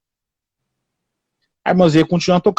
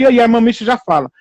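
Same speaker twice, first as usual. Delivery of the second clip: ordinary talk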